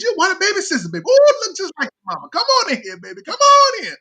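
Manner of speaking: loud, very high whooped welcome; imitating gushing relatives. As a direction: falsetto gush, long drawn "oh" to start. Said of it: "they say".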